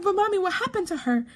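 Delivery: put-on high, quick little-boy voice; fast high-pitched kid imitation; child's question. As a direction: imitate a small son questioning his mommy. Retells her young son asking.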